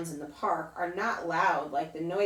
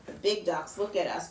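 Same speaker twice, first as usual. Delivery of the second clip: ordinary delivery, nothing marked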